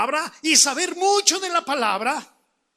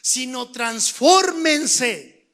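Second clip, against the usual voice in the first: "transfórmense" stretched out very slowly; emphatic repetition; 5 dB louder, much slower than usual. Shown as a demonstration. Preacher repeating the key word to the congregation, slow and emphatic on "transfórmense". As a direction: repeat it, leaning long and hard on "transfórmense". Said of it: "Otra vez repito esto".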